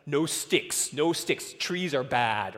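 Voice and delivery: loud, emphatic fatherly ban; mock stern ban. Father banning his son's pretend guns, mock stern and loud. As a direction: lay down the law with comic overstatement. Retelling his overstrict rule to son.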